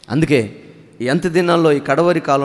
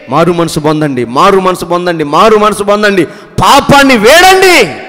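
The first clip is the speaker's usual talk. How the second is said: building call to repent; 8 dB louder than usual. Acted. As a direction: hammer the call harder every repetition. Noah calling people to repent.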